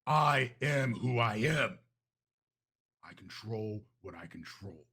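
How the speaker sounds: slow, eerie second self; the other voice. Acted. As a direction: let a strange inner voice take over, slow and cold. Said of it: "you hear a voice go".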